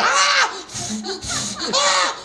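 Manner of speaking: high burning scream; pained scream. Retold or imitated. Screaming from medicine burn.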